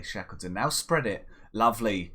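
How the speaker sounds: ordinary talk, nothing marked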